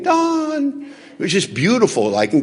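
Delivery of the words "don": high sing-song call of a name; a tool calling out to him in a high lilting voice; hammer calling name. Pretend. Hammer in store calling him in high voice.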